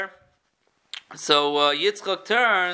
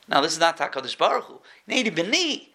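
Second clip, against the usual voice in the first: ordinary talk, nothing marked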